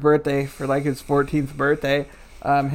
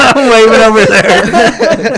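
laughing; 7 semitones higher, 14 dB louder, slower than usual. Real, not acted. Waving at the wrong screen.

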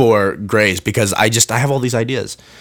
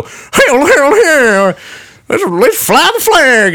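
much higher, slow hammed-up Southern drawl; mock Southern accent; 13 semitones higher, 3 dB louder, slower than usual. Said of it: "when they wanna sound like an idiot ... you go".

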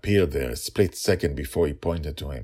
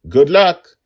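loud, high farewell call; 12 semitones higher, 16 dB louder than usual. A narrator's reading. Father calling goodbye to his daughter, loud and high. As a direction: shout it as you fly off.

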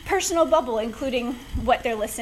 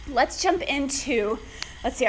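ordinary talk, nothing marked

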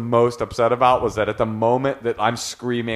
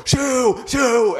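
mock yell; 14 semitones higher than usual. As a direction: shriek it at him. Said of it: "he would go".

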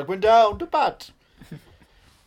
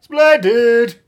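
theatrical; 5 semitones higher, 9 dB louder, much slower than usual. Pretend. Theatrical exclamation.

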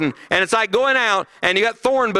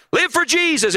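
mock preacher slogan; 8 semitones higher than usual. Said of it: "we preach things like".

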